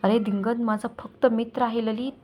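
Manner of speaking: ordinary delivery, nothing marked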